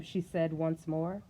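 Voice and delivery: plain reading voice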